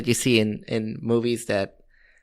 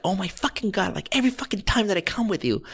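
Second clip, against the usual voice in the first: mock irritated scream; 7 semitones higher, faster than usual. Daughter complaining to her mom in line, high mock scream. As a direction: imitate a fed-up daughter, rattling it off high. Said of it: "she's screaming".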